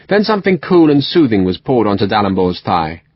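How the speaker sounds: ordinary delivery, nothing marked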